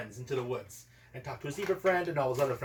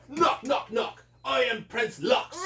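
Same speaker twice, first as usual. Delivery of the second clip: bold prince announcing; 8 semitones higher, 4 dB louder, slower than usual. Acted. Brave prince announces himself.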